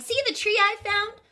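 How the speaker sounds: high, eager rabbit voice; excited cry. Acted. Benjamin Rabbit showing his family the tree, high and eager. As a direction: call it up high with delight. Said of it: "he cried".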